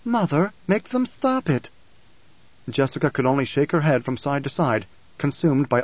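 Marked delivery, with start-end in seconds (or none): 0.0-1.7 high, slow, sweet plea; pleading young girl. A narrator's reading. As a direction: beg mother in a youthful lilt. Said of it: "She turned pleading eyes to Jessica".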